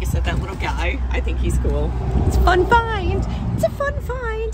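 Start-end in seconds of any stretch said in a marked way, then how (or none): 2.3-3.3 excited. Acted. Repeated catchphrase. 3.6-4.5 high silly voice. Acted. Repeated in a put-on voice.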